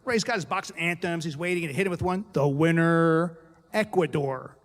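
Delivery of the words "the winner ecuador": slow, loud stretched announcer voice; announcer call. Acted. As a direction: boom it out like an awards announcer. Announcer at Olympic awards.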